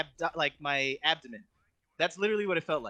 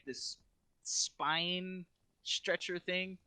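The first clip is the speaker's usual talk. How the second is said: normal talking voice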